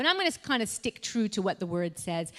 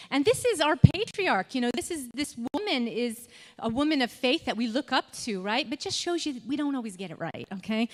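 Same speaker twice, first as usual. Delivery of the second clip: normal talking voice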